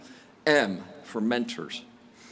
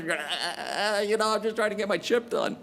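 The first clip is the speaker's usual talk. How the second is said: stammering; 6 semitones higher than usual. Retold or imitated. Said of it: "Shocked and stammered".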